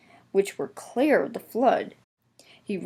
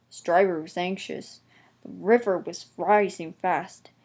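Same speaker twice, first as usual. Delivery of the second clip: plain reading voice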